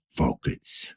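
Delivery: steady yourself under your breath; quiet whispered order to self; whispered self-command. Acted. Detective steadying himself.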